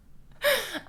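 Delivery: high squeaky embarrassed laugh. Real, not acted. Laughing at her own awkward question, high embarrassed giggle. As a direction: burst into a shrill nervous giggle. Nervous laugh after confession.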